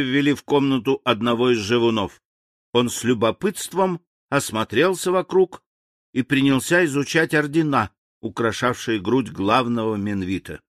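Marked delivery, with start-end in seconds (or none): none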